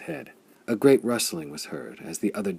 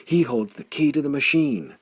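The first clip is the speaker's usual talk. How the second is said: higher and louder, rising awe; awed cry; 5 semitones higher, 3 dB louder than usual. A narrator's reading. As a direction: proclaim it like a revelation. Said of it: "howled the Darksiders".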